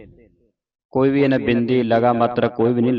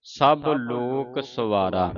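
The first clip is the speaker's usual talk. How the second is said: slow, drawn-out measured chant of the line; scripture recitation; 3 semitones higher, 4 dB quieter, much slower than usual. Shown as a demonstration. Teacher reciting a verse for students to follow, slow measured chant. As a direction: recite it slowly, holding the long vowels.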